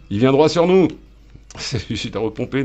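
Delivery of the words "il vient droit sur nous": loud, high cry of alarm; alarmed shout. Real, not acted. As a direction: shout a warning of incoming danger. Alerte face au vaisseau ennemi. (cri d'alerte, fort et aigu)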